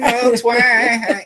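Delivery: "a little twang" said high and loud in a sung Southern twang. Retold or imitated. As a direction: imitate her singing twang on "a little twang". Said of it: "When she sang".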